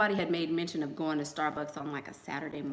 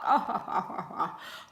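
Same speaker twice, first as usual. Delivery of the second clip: laughing; 3 semitones higher than usual. Retold or imitated. Laugh in impression.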